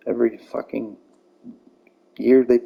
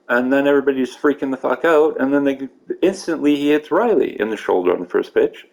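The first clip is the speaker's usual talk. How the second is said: ordinary delivery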